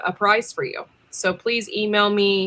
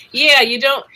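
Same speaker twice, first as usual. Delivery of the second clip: ordinary talk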